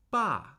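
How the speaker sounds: Ba starting high, falling straight down; fourth tone on Ba. Shown as a demonstration. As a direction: drop "Ba" firmly, like a command. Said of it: "the tone immediately starts steadily falling".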